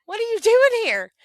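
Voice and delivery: shocked to see her mother appear; startled. Retold or imitated. Daughter startled by her dead mother in a dream, high surprised cry. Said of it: "And I said".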